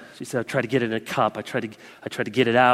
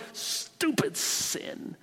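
slow whispered "Stupid sin." with a much higher peak on "Stupid"; hissed whisper; 12 semitones higher, 8 dB quieter, much slower than usual. Shown as a demonstration. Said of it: "That should be our attitude towards sin".

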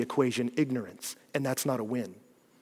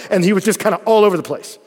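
ordinary talk

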